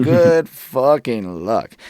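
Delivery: loud, drawn-out "good luck", every word leaned on; emphatic sarcastic wish. Real, not acted. Artist on English rappers' slim grant chances, sarcastically emphatic. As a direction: stretch it out loudly, mocking the slim odds.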